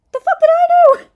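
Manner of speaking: very high, loud shriek; shrieked outburst. Real, not acted. Commentator outraged by the song's lyric, shrieking. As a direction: scream it in disbelief.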